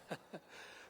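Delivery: a short soft chuckle; small amused laugh; quiet laugh. Acted. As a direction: laugh under your breath at the question. Greeting an old friend.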